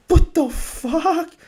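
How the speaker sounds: high, stretched out disbelief; mock outraged squeak; shocked viewer. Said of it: "you may be like".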